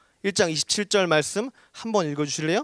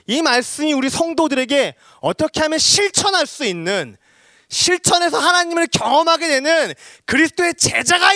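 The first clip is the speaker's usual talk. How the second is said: high, loud pulpit delivery; impassioned preaching; 10 semitones higher, 5 dB louder than usual. Real, not acted. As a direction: drive the point home at full force. Preaches at raised force.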